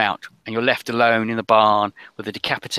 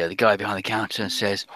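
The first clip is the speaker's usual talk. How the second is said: ordinary talk, nothing marked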